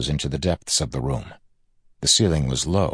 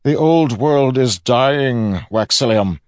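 loud, high, slow declamation; villain projecting grandly across a chamber; proclaiming; 8 semitones higher, 7 dB louder, slower than usual. A narrator's reading. Villain proclaims to his nephew, loud and slow.

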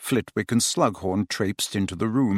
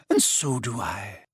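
soft, slow reassurance; kind; 4 dB quieter than usual. A narrator's reading. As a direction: reassure him gently. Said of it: "said Professor McGonagall kindly".

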